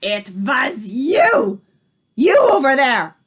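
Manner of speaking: slow, loud furious accusation; Grand High Witch singling out the culprit in rage; accusing. A narrator's reading. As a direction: point her out and explode.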